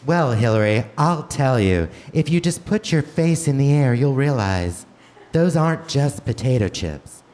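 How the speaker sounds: normal talking voice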